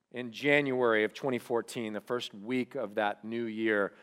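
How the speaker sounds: ordinary talk, nothing marked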